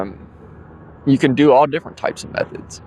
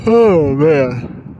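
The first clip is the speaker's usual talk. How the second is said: big yawn, higher and louder; 4 semitones higher, 4 dB louder than usual. Real, not acted. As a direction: let the yawn carry the words. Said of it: "makes you yawn a little bit".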